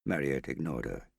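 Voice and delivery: ordinary delivery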